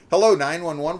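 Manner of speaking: high, loud, stilted phone greeting; mocking a caller. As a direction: badly act an emergency call. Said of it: "Nobody fucking just picks their phone".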